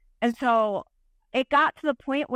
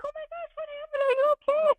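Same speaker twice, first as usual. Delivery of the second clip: much higher, mock-fretful voices; high, fussing imitation of the worried guests; worried onlookers. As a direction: play the alarmed crowd. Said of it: "everybody's like".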